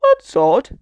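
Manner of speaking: high, loud puzzled character; animated scatty storybook voice; Miss Scatterbrain. A narrator's reading. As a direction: pitch it up and project it. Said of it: "said Miss Scatterbrain".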